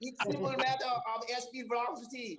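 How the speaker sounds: high, laughing mock-professor voice; silly high voice. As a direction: play a pompous expert, giggling. Mock posh scientist voice, laughing.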